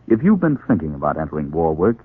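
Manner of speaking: ordinary delivery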